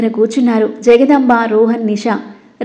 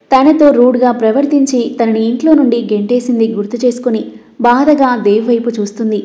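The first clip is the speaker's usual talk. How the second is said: usual speaking voice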